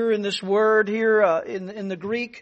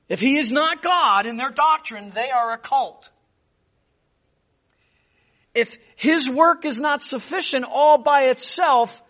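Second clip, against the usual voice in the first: louder, higher pulpit warning; forceful preaching; 5 semitones higher, 7 dB louder than usual. Acted. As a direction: drive the warning home. Warning about cults, forceful.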